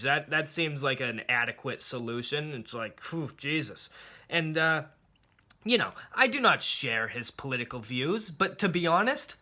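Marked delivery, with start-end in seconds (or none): none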